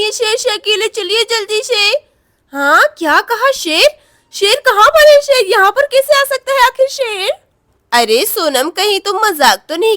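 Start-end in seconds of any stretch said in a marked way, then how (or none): 2.5-7.3 higher and louder, startled; can't believe a lion is here; shocked disbelief. Acted. Mother hears there is a lion. (चौंककर ऊँची तेज़ आवाज़)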